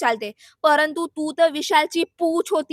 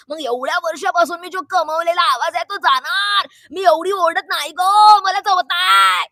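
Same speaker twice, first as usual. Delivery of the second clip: mock wailing shout; 3 semitones higher, 6 dB louder than usual. Mimicking contestant guarding her hard-earned voice, mock wailing shout. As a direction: belt it out in hammed-up protest.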